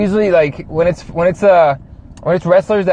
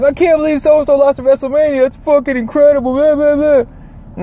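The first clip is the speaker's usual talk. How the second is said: mock whiny fan; 8 semitones higher than usual. Mocking upset wrestling fans.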